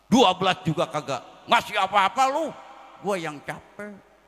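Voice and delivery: a fed-up wife snapping at her husband; angry wife. Retold or imitated. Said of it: "Istrinya marah". (istri marah, meninggi lalu melambat)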